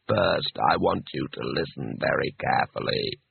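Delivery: low, deep disembodied voice; patient. A narrator's reading. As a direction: explain patiently from down in the depths. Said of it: "disembodied voice patiently".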